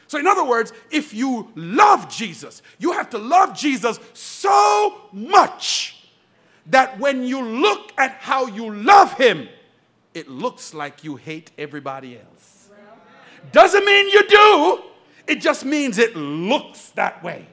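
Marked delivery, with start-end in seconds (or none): 2.8-12.7 slow, loud, drawn-out proclamation; a preacher shouting his point to the church; slow preaching shout. Real, not acted. Preaching climax. 13.5-14.8 much higher, much louder, slow; preacher shouting a correction to the church; high emphatic shout. Real, not acted. Preacher's sudden emphatic turn.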